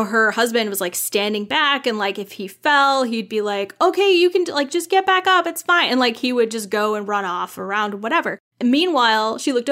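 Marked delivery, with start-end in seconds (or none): 3.8-5.9 lightly voiced dad, noticeably higher; easygoing dad. Husband to his fallen son at the playground, lightly voiced easygoing dad. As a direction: imitate a breezy dad reassuring his kid.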